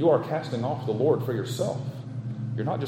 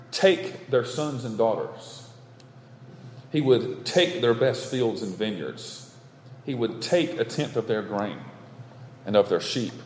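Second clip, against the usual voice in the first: normal talking voice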